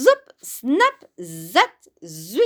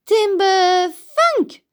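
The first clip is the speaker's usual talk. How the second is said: loud call; 10 semitones higher, 7 dB louder, slower than usual. Acted. Tree falls.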